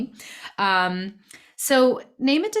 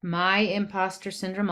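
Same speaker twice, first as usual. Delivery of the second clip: usual speaking voice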